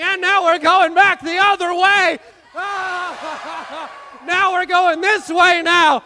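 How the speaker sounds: high, loud excited shouts through the storm; storm excitement. Acted. Captain riding out the storm with the kids, excited shouting. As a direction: yell over the wind as the ship rocks. Acted storm tossing the ship.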